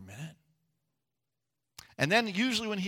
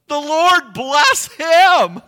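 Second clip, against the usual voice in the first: much higher, louder, slow exclamation; Naomi's joyful blessing; 13 semitones higher, 18 dB louder, much slower than usual. Retold or imitated. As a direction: cry out the blessing in joy. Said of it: "Naomi said".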